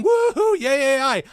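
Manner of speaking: high, mocking woohoo; mock cheer. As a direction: cheer sarcastically for AI. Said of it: "I'm not saying".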